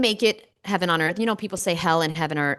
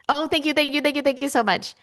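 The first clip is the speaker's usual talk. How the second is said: gushing thanks; 9 semitones higher than usual. Real, not acted. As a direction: squeal your thanks to the chat. Viewer says yes, gushing thanks.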